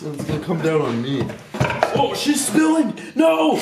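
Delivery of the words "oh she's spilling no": excited shout. Real, not acted. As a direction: yell out as it spills. Fluid spills on him.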